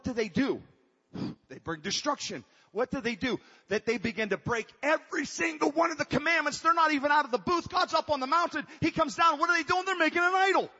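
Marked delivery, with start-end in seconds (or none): 2.7-10.7 much higher and louder, preaching at full throttle; full-force preaching. Acted. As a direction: build the sermon to a fervent peak.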